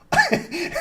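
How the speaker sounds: a sudden high squeal of a laugh; high burst of laughter. Real, not acted. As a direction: laugh out loud, high and sharp. Cracking up at the joke.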